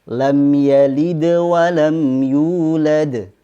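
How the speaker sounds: careful tajweed recitation of the verse; slow recitation. Shown as a demonstration. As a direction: chant lam yalid wa lam yulad with the d flowing into wa. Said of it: "contoh lain pada ayat".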